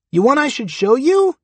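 high, squeaky little critter. A narrator's reading. Durden's dick answering him, high squeak. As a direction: pipe it up high and cheeky.

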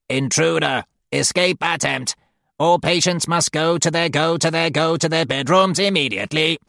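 robotic alarm announcement; mechanical voice. Acted. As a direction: blare it like a stuck machine. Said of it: "a mechanical voice blatting".

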